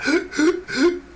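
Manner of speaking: high, gasping laugh imitation; catch-your-breath laugh. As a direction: mimic gasping for air between laughs. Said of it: "trying to catch your breath laugh".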